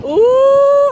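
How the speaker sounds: very high, loud shocked ooh. Retold or imitated. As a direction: squeal an ooh of alarm. Reacting, bus driving off.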